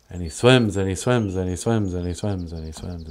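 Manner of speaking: low, rhythmic chant that steadily softens; sinking away into the depths; fading narration. A narrator's reading. Dive into the deep, fading.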